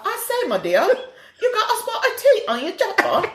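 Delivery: high, hammed-up posh British accent; a prim British lady tutting, high; mock posh British. Host answering her co-host in the bit, mock posh British.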